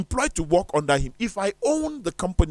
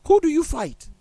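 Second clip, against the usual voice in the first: higher, and the word Who rings out; forceful repeat; 12 semitones higher, 4 dB louder than usual. Acted. Preacher presses his friend with the question, forceful repeat. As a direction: fire the question at them.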